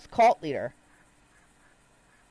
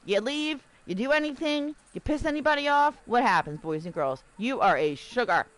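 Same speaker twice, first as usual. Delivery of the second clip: ordinary talk, nothing marked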